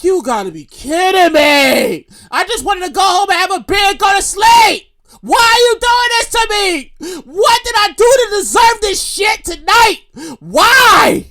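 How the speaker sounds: high, drawn-out pleading wail; hammed-up anguish. Stranded rider wants home and sleep, hammed-up wailing. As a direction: wail it slowly in mock despair, building to a shout.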